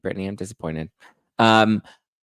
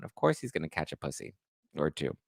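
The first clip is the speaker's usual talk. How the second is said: normal talking voice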